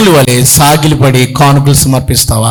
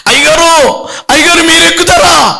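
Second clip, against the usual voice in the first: shocked cry; 13 semitones higher than usual. Acted. Man shocked pastor boards van.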